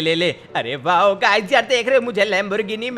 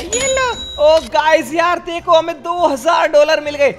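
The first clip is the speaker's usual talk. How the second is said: much higher, louder squeal of joy; thrilled cheer; 9 semitones higher, 6 dB louder than usual. Acted. Hero thrilled to get two thousand dollars, loud high cheer. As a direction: celebrate the windfall.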